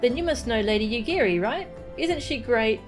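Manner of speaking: put-on bouncy child voice with swooping pitch; inquisitive child. Kaharu found hiding by a stranger, inquisitive child. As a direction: play a chatty, curious kid.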